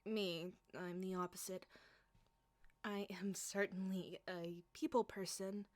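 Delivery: ordinary talk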